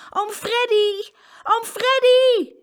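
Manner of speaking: shouting with all might. A narrator's reading. Trapped boy calling his uncle, shouting hard. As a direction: yell the name as hard as you can. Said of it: "zo hard hij kon".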